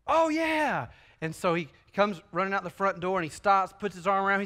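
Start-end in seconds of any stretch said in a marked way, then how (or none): 0.1-0.9 put-on kid's excited yes.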